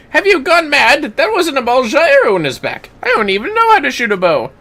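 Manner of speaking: high, loud, fast protest; outraged accused man. Acted. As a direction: deny the murder indignantly. Voices accused character.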